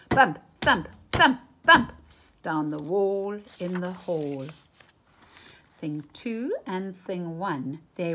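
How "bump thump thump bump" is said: loud, punched crash words rising higher; booming sound words. A narrator's reading. Narrator hammers out the kites crashing into the wall. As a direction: bang out each bump like a crash.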